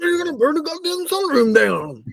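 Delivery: high, mock panicky buddy; high imitation of Mike warning about the fire. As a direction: squeak it like a panicking friend. Mike quoted in story.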